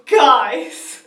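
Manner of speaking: very loud, excited call to the family; thrilled alarm. Retold or imitated. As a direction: blurt it out loud, near laughter. TARDIS noise, alerting family.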